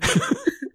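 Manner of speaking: very high burst of laughter. Real, not acted. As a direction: squeal of laughter.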